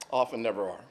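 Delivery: softly repeated for weight; quiet echo. Shown as a demonstration. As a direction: let it fall away quietly.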